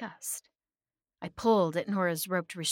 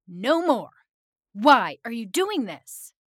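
loud, high, outraged demand; screamed protest; 5 semitones higher, 10 dB louder than usual. A narrator's reading. Victim confronting the being who keeps killing them, screaming. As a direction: scream it right in his face. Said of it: "I screamed in his face".

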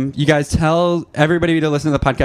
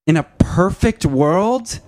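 very slow, higher, drawn-out punchline delivery; Natalie; 9 semitones higher, much slower than usual. Retold or imitated. Co-host doing the comedian's joke to her, lofty slow imitation. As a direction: stretch every word into a lofty singsong. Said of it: "this is me as Natalie".

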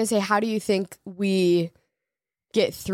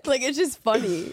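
higher, real amused giggle in the voice; laughing; 4 semitones higher than usual. Real, not acted. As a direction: say it through leftover laughter. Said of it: "I'm just laughing".

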